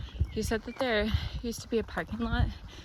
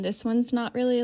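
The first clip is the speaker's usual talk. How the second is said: ordinary delivery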